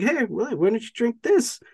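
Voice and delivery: high-pitched mocking offer; playful retold taunt. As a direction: dangle it in a teasing, high voice. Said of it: "Tuco's, like, taunting him".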